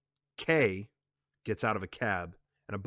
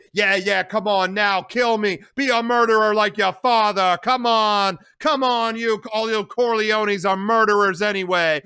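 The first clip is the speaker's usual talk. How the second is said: higher, loud, goading; defiant taunting; 10 semitones higher, 10 dB louder than usual. Acted. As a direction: dare him to kill her. Connie taunts with knife.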